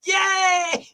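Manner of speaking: high delighted yay; excited cheer. Real, not acted. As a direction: cheer him on with joy. Celebrating a right guess.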